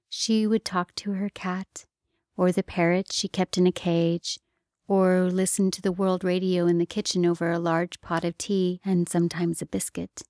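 plain reading voice

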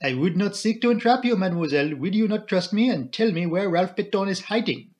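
put-on coaxing papa figure with accent; earnest foreign detective, rising in pitch; Poirot. Poirot asks Flora to trust him, coaxing and accented.

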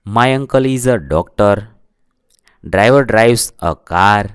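very slow, word by word example sentences; slow, clear classroom dictation. Shown as a demonstration. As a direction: read each sentence slowly so students can write it down.

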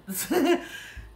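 high giggling laugh; delighted laugh. Real, not acted. Reader tickled by the love cards, delighted laugh.

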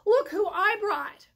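high, loud showing off; proud announcing. Acted. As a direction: present the guests proudly. Proud presentation.

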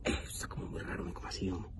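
breathy whisper to himself; uneasy muttered thought. Retold or imitated. (susurro inquieto para sí mismo)

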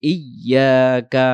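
"ইয়াকা" chanted slowly as iy-yaa-ka; doubled Y. Shown as a demonstration. Teacher modelling the right word, "ইয়াকা" as slow iy-yaa-ka. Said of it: "উচ্চারণ হবে হামজা ইয়াজের ই".

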